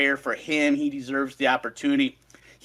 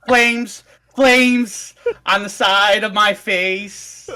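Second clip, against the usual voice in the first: higher, loud theatrical film quote; dramatic movie quote; 7 semitones higher, 8 dB louder, much slower than usual. Acted. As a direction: quote it big and drawn-out, with intensity. Quoting a film line dramatically.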